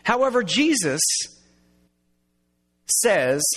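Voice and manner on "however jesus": usual speaking voice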